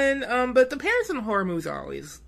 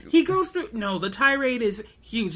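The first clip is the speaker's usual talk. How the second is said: normal talking voice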